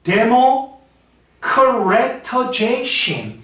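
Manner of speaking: "Democratization" drawn out very slowly, part by part; slow demonstration. Shown as a demonstration. Teacher showing students how to read "Democratization", very slowly. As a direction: stretch each syllable of "Democratization" with gaps between. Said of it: "그럼 이걸 어떻게 읽을까".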